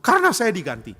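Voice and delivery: loud, high sulky retort; acted huffy outburst; sulking. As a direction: blurt the grievance out. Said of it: "ngambek, kita tanya".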